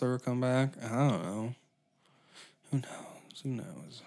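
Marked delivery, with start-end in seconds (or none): 2.7-3.4 whispering. Pretend. Repeated whispered.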